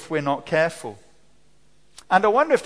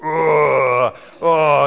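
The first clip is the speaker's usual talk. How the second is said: low disgruntled groan, drawn out; sulky grumbling groan; 4 semitones lower, 6 dB louder than usual. Shown as a demonstration. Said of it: "It sounds a bit like this".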